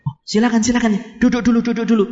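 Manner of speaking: mock eager host, voice lifted a little; acted welcoming host; eager welcome. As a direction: usher the guest in eagerly. Host welcomes the sweet-talking tempter.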